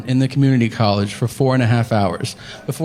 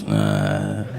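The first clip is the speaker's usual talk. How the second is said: very low, lazy grunt into the phone; a bored caveman noise instead of hello; dull grunt; 3 dB quieter than usual. Retold or imitated. Man answering a phone call with a low grunt. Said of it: "answers the phone with a noise".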